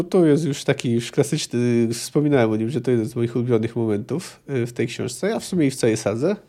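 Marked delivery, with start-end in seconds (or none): none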